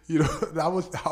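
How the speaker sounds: real chuckle breaking into the words; amused, laugh escaping mid-sentence; laughing while talking. Real, not acted. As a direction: try to get it out through a laugh. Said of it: "I'm laughing now".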